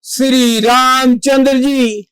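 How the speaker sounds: ordinary talk, nothing marked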